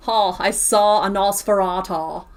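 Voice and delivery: slow, hammed-up Australian drawl; mock Australian accent, dragged out; exaggerated Australian accent. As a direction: draw out the vowels in broad Aussie. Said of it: "mean to ask an Australian to pronounce Nosferatu".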